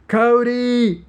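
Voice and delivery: loud, high calling shout; holler. Acted. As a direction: call out to someone far away. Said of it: "hollered Angelo".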